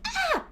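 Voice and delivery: sudden shrill yelp of fright; high-pitched scream. Retold or imitated. Yamcha startled by Frieza, high yelp. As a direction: Yamcha yelps in panic. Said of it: "just screams".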